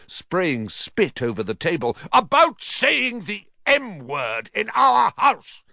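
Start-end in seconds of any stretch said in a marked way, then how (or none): 2.1-5.6 high, slow outraged shout; thundering. A narrator's reading. Uncle scolding over a banned word, thundering. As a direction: hammer the words out furiously. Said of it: "thundered his uncle".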